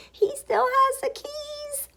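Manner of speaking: very high, squeaky reveal; high-pitched amazed narration; gleeful discovery. A narrator's reading. Discovers gorilla kept keys.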